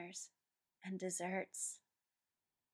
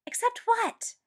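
loud, much higher, furious; furious demand; 11 dB louder than usual. A narrator's reading. Ed furiously demanding Mustang finish. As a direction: snap the question back hard. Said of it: "Ed demanded, furious".

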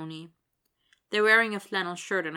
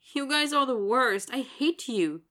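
higher, louder mock-exasperated groan; 7 semitones higher, 5 dB louder than usual. A narrator's reading. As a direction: groan it out at your friends. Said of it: "Willie groans".

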